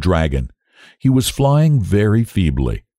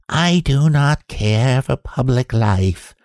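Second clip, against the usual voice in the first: high, slow, prim creature voice; a shy beast declining fame, drawn out; Manticora's voice; 6 semitones higher, slower than usual. A narrator's reading. The Manticora asking the king to return to the book, high and slow. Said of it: "he said".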